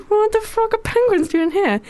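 high, amazed tourist voice; mock astonishment. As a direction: gasp it out in disbelief. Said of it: "everyone's just like".

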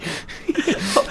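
breathy nervous giggle; a short uneasy laugh. Real, not acted. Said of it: "Nervous laughter".

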